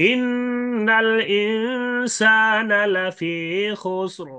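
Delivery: very slow chanted ইন্নাল ইনসানা লাফি খুসর; recited with sukun stop. Shown as a demonstration. Teacher reciting the verse to show the sukun stop. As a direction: recite it drawn out and high, stopping silent on খুসর. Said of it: "এটা পুর করে যেমন সাকিন".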